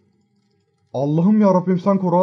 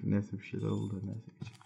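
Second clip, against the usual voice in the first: usual speaking voice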